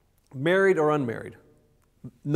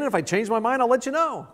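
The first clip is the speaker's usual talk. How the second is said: higher, airy dismissive promise; breezy brush-off; 8 semitones higher than usual. Pretend. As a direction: shrug it off lightly. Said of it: "responding with".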